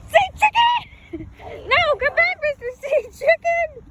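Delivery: high call after a bird. Real, not acted. Calling after a goose.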